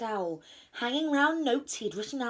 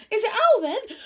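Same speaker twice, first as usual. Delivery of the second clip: high, loud anxious question; nervous gulp; 12 semitones higher, 10 dB louder than usual. A narrator's reading. Rabbit asks who is knocking, nervous and high. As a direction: blurt it out in alarm. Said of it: "Rabbit gulped".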